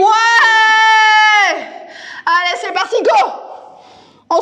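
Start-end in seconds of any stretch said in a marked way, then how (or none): 0.0-1.6 loud cheering yell; excited shout. Real, not acted. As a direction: pump up the room. Class answers yes.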